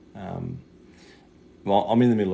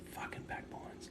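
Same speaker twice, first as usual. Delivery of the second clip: under-the-breath whisper; 19 dB quieter than usual. Real, not acted. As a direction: whisper it to yourself. Muttered aside about old suburb.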